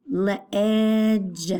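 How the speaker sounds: "ledge" stretched as luh-ehdge; short E. Shown as a demonstration. Teacher sounding out the vowel for pupils, "ledge" with stretched short E. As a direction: draw out the short eh inside "ledge". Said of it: "does not have a long sound".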